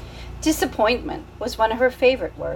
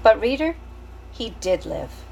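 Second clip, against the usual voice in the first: intimate narrator. A narrator's reading. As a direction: confide it to the reader. Narrator closing to the reader.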